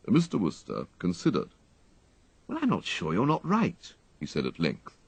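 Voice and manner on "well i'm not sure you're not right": a hint of a conceding gentleman; reluctantly giving in; lightly voiced upper-class toff; grudging young toff. A narrator's reading. Wooster's voice in story.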